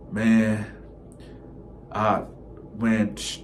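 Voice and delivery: ordinary delivery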